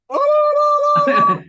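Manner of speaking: shrill alarmed no no no; high panicked refusal. Real, not acted. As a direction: squeal it out in alarm.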